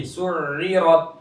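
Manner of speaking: slow सुइरत with the throat sound before इ held on; continuous ain. Shown as a demonstration. Teacher shows the continuing sound, slow सुइरत. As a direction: keep the sound flowing through सुइरत's doubled middle. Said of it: "आवाज जारी रहेगी".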